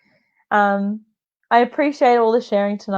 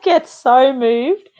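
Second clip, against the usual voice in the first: ordinary delivery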